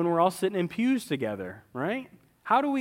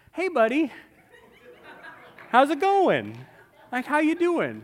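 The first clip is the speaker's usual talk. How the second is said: much higher, louder, laughing over-eager greeting; Brian Rodert impression; 11 semitones higher, 4 dB louder than usual. Church friends mimic a pastor's gushing hallway hello, high and loud. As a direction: lay on the gushing buddy hello, pitched way up. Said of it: "their best Brian Rodert impression".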